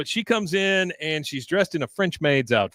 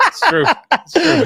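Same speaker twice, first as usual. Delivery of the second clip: laughing insistence; 9 dB louder than usual. Real, not acted. Laughing insistence after surprise.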